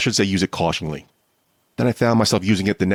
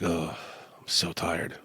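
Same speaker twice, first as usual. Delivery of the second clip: much softer, drained groaning murmur; tired groan; 2 semitones lower, 11 dB quieter, slower than usual. Retold or imitated. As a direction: sag into it, exhausted, half-voiced. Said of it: "I'm like".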